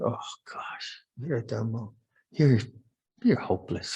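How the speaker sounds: hushed, breathy self-reproach under the breath; self-scolding whisper. Retold or imitated. Monk berating himself after yelling at another monk, whispered. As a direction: scold yourself in a near-silent whisper.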